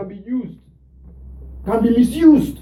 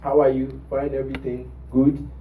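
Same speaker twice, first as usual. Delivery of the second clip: gentle coaxing; 9 semitones lower, 8 dB quieter than usual. Retold or imitated. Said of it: "I said".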